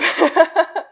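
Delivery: a high loud laugh; high burst of laughter. Real, not acted. Laughs at own joke. (громкий высокий смех)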